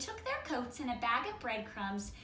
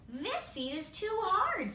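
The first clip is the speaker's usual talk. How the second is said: higher, louder grumbling bear; 8 semitones higher, 4 dB louder than usual. Acted. Reading the bear trying seats, higher and louder. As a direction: complain like a picky bear. Bear finds seat hard.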